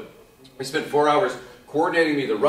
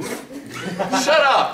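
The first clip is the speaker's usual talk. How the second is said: playful disbelief; 13 semitones higher, 4 dB louder than usual. Real, not acted. Laughing disbelief at Titanic two.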